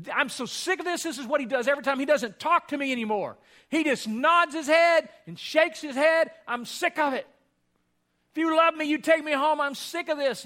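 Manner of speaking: high, loud, fed-up complaining; mother scolding her husband who only nods; angry mother. Retold or imitated. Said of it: "And she gets angry and angry".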